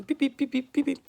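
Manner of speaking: high beeping pip pip alarm. Pretend. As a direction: squeak like a pregnancy alarm. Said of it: "Ne faites pas attention avec conneries".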